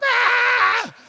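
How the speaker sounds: very high, screaming fake wail; harder mock crying. Kid cries harder to wake someone, piercing mock wail. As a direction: bawl much too hard on purpose. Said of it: "I gotta cry a little hard".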